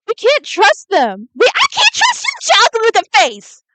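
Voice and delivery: loud outburst climbing very high; getting mad. Real, not acted. Player refusing to trust the others, mad high-pitched yelling. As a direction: let it boil over, high and loud. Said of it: "I'm getting mad".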